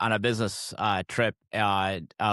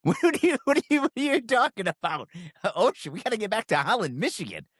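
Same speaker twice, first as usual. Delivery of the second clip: much higher, laughing incredulous cry; shocked they meant across the ocean, pitch shooting up; incredulous disbelief; 10 semitones higher than usual. Retold or imitated. Retelling shocked reply about the ocean.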